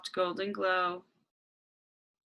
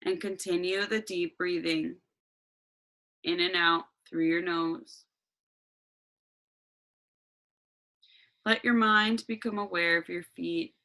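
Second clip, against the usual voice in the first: guided meditation. Acted.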